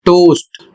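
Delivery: टोस्ट said slowly as "tohst", long round O; टोस्ट pronounced like a teacher's example word; careful English pronunciation. Shown as a demonstration. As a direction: model टोस्ट with a clear long O and crisp final T. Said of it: "इसका उच्चारण होगा".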